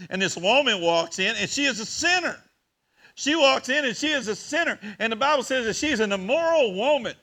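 building pulpit preaching; preaching at full force. Acted. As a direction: lift it to the congregation like a fired-up preacher. Raised preaching about the sinful woman.

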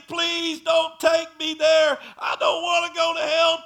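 high, loud, slow begging cry; desperate pleading cry. Retold or imitated. Dying mother begs not to be taken through the gate, crying out in terror. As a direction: beg not to be taken, terrified.

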